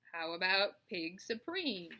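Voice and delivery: slow, bleating suggestion; lamb suggesting. Acted. A lamb suggests a slogan to Charlotte, slow and bleating. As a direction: drawl it out hopefully. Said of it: "asked one of the lambs".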